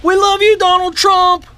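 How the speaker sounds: thrilled holler, stretching the words; real fan yell. Real, not acted. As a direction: yell it out to someone across the street. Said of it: "when we yelled".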